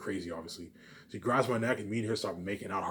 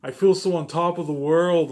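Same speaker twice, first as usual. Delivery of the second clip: imitating her excitement; 6 semitones higher, 9 dB louder than usual. Imitating his girlfriend thrilled at being famous, high and loud. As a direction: gush like she's on top of the world. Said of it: "V J will be like".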